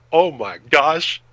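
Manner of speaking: ordinary talk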